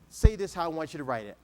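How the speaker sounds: ordinary delivery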